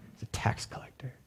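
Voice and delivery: secretive whisper to the room; preacher whispering an aside to the congregation. Real, not acted. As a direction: let them in on a secret. Aside about Matthew.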